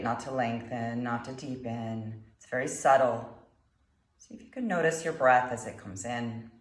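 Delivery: calm meditation guide, long pauses between phrases; guided meditation. Acted. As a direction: guide them gently through the breath, unhurried.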